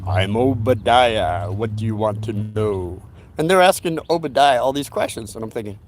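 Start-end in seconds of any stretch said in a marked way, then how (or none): 0.1-3.0 low, very slow and drawn out, like a spirit speaking through her; deep channeled voice. Retold or imitated. The psychic channels Obadiah for the séance guests, deep slow voice. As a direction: let Obadiah rumble through, each word stretched. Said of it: "a real deep voice".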